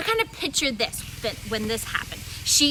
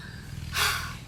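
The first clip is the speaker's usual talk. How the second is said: heavy sigh; 7 dB quieter than usual. Acted. Sick woman thinking alone.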